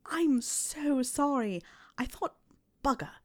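slow, startled apology; flustered. A narrator's reading. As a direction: caught off guard and backing out. Intruder flustered, retreating.

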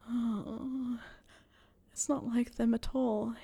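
breathless, unvoiced whisper; gasped whisper. A narrator's reading. Harry gasping to Snape, breathless whisper. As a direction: gasp the words out. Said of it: "he gasped out".

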